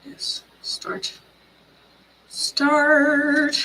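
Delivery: normal talking voice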